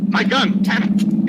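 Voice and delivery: high, loud cry over the scuffle; panicked shout in a moving car; alarmed shout. Acted. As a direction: yell for help as the gun is grabbed. Struggle over gun in car.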